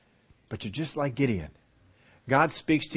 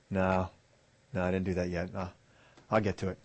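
evasive mumble; 4 semitones lower, 6 dB quieter than usual. Retold or imitated. Student putting off meeting.

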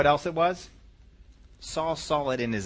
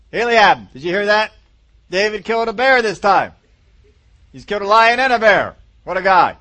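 loud, raised-voice announcement to a brother; mock amazement; 6 semitones higher, 11 dB louder than usual. Family member calls to Eliab about David, mock amazement. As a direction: call out the news with exaggerated wonder.

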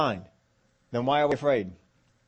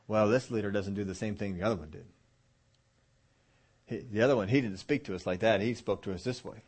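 grumbling comparison; 5 semitones lower, 4 dB quieter than usual. Acted. Acts out people comparing new leader.

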